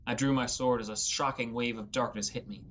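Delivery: usual speaking voice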